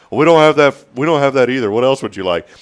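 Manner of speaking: rude clerk. Retold or imitated. Dave refusing the customer's order, curt and loud. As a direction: snap back like a fed-up cashier. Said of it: "rude to people".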